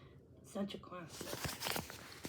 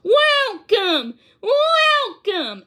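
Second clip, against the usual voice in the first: big, much louder, much higher greeting; bellowing welcome; 9 semitones higher, 7 dB louder, much slower than usual. A narrator's reading. Mister Baker greeting the scouts at the door, bellowing. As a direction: boom it out to the guests. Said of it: "as he bellowed".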